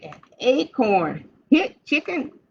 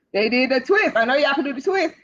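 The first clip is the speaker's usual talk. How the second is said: plain reading voice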